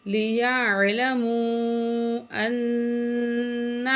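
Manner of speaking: ان held with a nasal hum; ghunna on nun. Shown as a demonstration. Teacher demonstrates nasal ghunna to students, slow chant. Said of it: "نون میں غنہ کرنا ہے".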